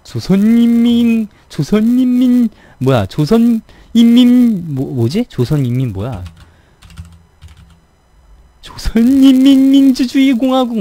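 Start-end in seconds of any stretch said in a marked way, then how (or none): 0.0-4.7 high, loud, slow North Korean broadcast voice; 조선인민 stretched out high and loud, North Korean broadcast accent; North Korean announcer style. Shown as a demonstration. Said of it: "이북 사투리가 어려운 것 같은데". 8.6-10.8 high, loud proclamation of 조선인민민주주의공화국; North Korean announcer style. Shown as a demonstration. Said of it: "막 이런 거".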